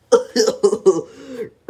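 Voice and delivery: a mocking burst of laughter; laughing. A narrator's reading. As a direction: laugh at the dumb answer. Pig laughs at Mister Dizzy.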